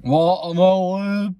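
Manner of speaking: anuncio exagerado y lento; hammed-up announcer. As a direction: stretch out the reveal, loud and high. Announcing the next snack.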